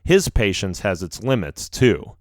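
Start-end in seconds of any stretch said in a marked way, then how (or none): none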